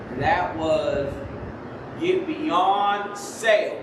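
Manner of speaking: ordinary talk, nothing marked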